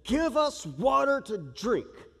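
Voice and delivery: quarrelling crowd. Acted. Israelites quarrel with Moses over water, slow demanding whine. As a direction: whine it like thirsty complainers. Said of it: "So they quarreled with Moses and said".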